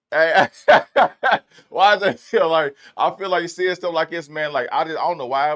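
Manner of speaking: loud, laughing burst of hype; excited outburst. Real, not acted. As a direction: blurt it out with a laugh, thrilled. Sudden hype reaction to the video.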